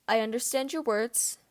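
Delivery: slow, measured words; careful. Acted. Regulus answers James, slow and careful. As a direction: weigh every word, slowly. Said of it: "he says carefully".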